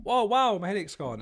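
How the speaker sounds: high, loud mock amazement; mock relief. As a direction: act amazed.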